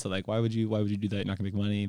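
ordinary talk

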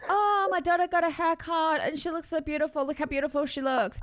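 high, proud gushing dad voice; father bragging to his Facebook friends, high and gushing. Retold or imitated. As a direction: gush like a doting father showing off a photo. Dad proudly sharing the wrong photo.

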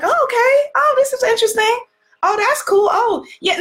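high, offhand little reactions; mildly interested onlooker. Acted. Acts out a casual, uncommitted viewer.

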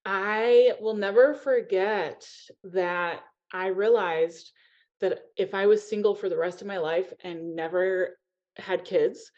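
usual speaking voice

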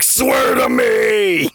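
slow, strained vigilante roar; Batman. Acted. Batman climaxing with Catwoman, shouted Bale impression. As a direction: drag the words out in a rough shout. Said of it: "he's just like".

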